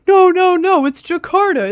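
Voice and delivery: sudden loud high no no no; excited realization. Real, not acted. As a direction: burst out as it clicks. Realizing the answer mid-guess.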